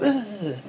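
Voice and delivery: high-pitched mock grunt of exertion; imitated old lady's high strained "ugh"; imitated effort grunt. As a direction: grunt as if swinging a cane. Imitates old lady swinging her cane.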